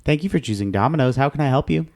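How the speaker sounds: fast, upbeat clerk greeting; phone greeting. Retold or imitated. Repeating the Domino's phone greeting, quick and upbeat. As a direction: rattle it off like a pizza clerk. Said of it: "repeats the phone greeting".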